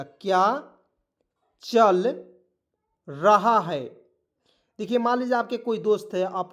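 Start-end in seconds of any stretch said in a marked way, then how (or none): 0.0-3.8 क्या, चल, रहा है spaced apart with pauses; word by word dictation. Shown as a demonstration. Teacher dictates क्या चल रहा है to students, word by word. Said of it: "याद रखिएगा".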